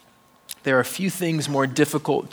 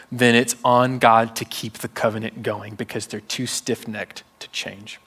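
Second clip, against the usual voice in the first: ordinary talk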